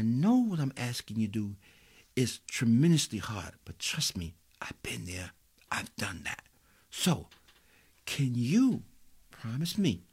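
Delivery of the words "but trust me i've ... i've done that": hushed confiding whisper; a secret shared in a breath. Real, not acted. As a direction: lean in and whisper it. Whispered aside to the congregation.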